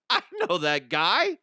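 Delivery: high, loud gossiping voice ending in a squeal of disgust; shrill-pitched mock gossip, yelping ew at the end. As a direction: play a neighbour appalled by the news. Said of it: "people are going".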